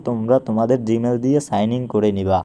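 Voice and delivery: ordinary talk, nothing marked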